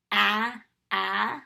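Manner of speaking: A, a as two short loud ahs like apple; short a sounds. Shown as a demonstration. Teacher leading the vowel chant, loud short a. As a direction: bark out the short a twice for the class. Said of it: "as I say the sound".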